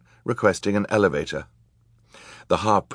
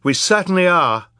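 put-on high, loud, defiant girl; fierce agreement; 12 semitones higher, 6 dB louder than usual. A narrator's reading. Violet answering the villains' charge, fierce and high. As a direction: agree fiercely, voice pitched right up. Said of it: "Violet agreed fiercely".

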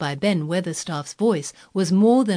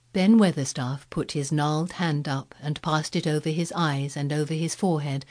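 ordinary delivery

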